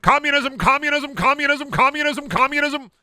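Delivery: high, shouty retro announcer chanting; hammed-up vintage announcer, high-pitched; 1950s radio announcer. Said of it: "nineteen fifties radio announcer would be like".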